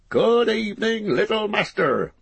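high-pitched, hearty landlord's greeting; bustling innkeeper. Acted. Landlord to a newly arrived hobbit, bustling welcome. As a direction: greet the guest in a high, lively character voice.

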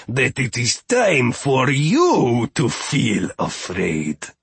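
slow, deliberate menacing pronouncement; piqued threat. A narrator's reading. Steward threatens the visitors, slow and piqued. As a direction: warn them with theatrical relish. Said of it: "in a fit of intense pique".

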